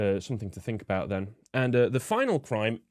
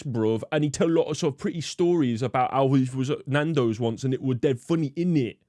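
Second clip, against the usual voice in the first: mock street slang; 2 semitones higher than usual. Sneering at the ideal Nando's man in mock London slang. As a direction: slip into a mocking London youth voice. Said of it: "he talk like this".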